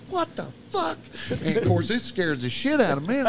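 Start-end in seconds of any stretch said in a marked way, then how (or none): 0.1-1.0 high-pitched startled retort; acted-out shock, pitch jumping up; startled disbelief. Startled awake by friend's scream.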